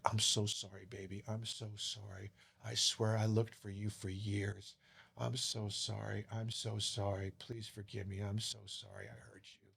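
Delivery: barely able to get the words out, pleading; tearful apology. Retold or imitated. Father begging his daughter for forgiveness, hushed and tearful.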